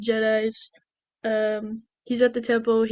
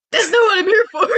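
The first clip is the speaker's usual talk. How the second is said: high, loud mock wail; hammed-up protest; 8 semitones higher, 6 dB louder than usual. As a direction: cry it out in exaggerated exasperation. Retold Padme refuses again, escalating.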